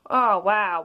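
usual speaking voice

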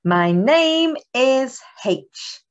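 slow, drawn-out teaching voice; slow clear phonics model; slow model phrase. Shown as a demonstration. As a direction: say each word slowly for the children. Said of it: "Can you do that?".

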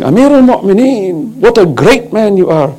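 high, lilting mock flattery; acted fawning praise. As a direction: play a courtier praising the ruler. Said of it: "He's praising".

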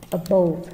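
a-BOVE, weight on "bove"; second-syllable stress. Shown as a demonstration. Teacher rereading the options, "above" stressed on "bove". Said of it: "Above là nhấn âm hai".

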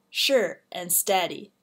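ordinary delivery

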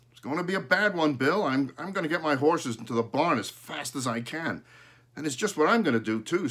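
fast, loud, high urgent talk; hurried farmers. A narrator's reading. Farmers call to each other before storm, fast and loud.

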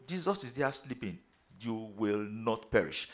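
normal talking voice

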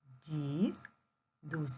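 "Дідусь" broken in two with a long gap; slow "Ді... дусь" for counting syllables. Shown as a demonstration. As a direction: pause between "ді" and "дусь". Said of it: "Поділимо слово на склади".

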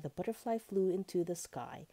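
ordinary delivery, nothing marked

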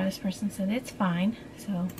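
ordinary delivery

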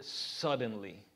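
suddenly said slow and low, stretched out; drawn-out suddenly. Shown as a demonstration. Preacher lingering on the word to stress it. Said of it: "pay attention to that word".

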